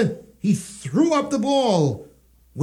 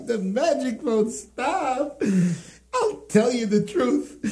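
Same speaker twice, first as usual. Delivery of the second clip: laughing hard; 6 semitones higher than usual. Acted.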